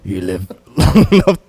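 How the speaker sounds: very low movie tough-guy delivery; deep hero voice. Acted. Friend retrying the quote before cracking up, deep voice. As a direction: drop the pitch right down and intone it.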